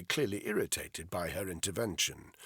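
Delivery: ordinary talk, nothing marked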